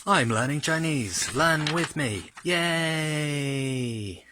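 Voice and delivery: high, bright upbeat cheer; excited cheer. Acted. Host cheering viewers on to learn with him.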